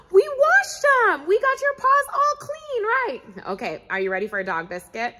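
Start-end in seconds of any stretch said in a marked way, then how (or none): 0.1-3.2 high, slow delighted praise; excited. Pretend. Host praising Chipper's clean paws, high and excited. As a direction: celebrate it with the kids.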